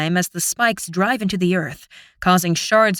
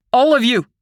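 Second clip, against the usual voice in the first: shouting; 4 semitones higher, 5 dB louder than usual. A narrator's reading. Wounded man orders them away.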